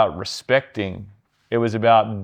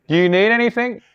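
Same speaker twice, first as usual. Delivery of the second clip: high British radio voice, louder; airy, raised British officer voice; 12 semitones higher, 5 dB louder than usual. Retold or imitated. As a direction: offer help in a high-pitched British lilt. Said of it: "like, this British voice on the radio".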